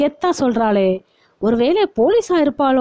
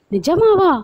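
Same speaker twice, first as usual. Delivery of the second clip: thrilled; 8 semitones higher, slower than usual. A narrator's reading. Girl thrilled by fish offer.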